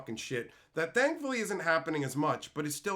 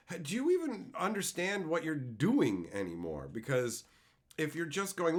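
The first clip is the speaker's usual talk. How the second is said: ordinary talk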